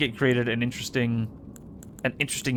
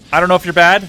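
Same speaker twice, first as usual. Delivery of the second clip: hotheaded shouting; 6 semitones higher, 10 dB louder, faster than usual. Real, not acted. Taunting enemy.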